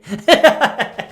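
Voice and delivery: high, loud burst of laughter; laughing. Real, not acted. Joking about an elderly lady's weathered look, high loud laugh. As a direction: crack up at your own cheeky joke. Laughs at own rude retort.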